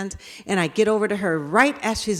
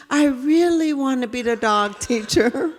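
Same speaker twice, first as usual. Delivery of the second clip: put-on happy little boy; 5 semitones higher than usual. Boy insists, giggling.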